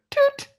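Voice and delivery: very high, loud "Toot!" like a tiny car horn; horn imitation. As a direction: squeak out the little horn.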